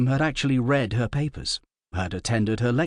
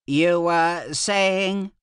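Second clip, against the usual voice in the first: slow, cold and pointed; a small ruler demanding silence; glaring emperor; 7 semitones higher, 3 dB louder, much slower than usual. A narrator's reading. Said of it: "He glared around at them".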